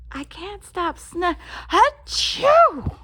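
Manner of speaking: word cut off by a loud high sneeze; hammed-up sneeze breaking the sentence; interrupted by a sneeze. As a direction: start talking and explode into a sneeze.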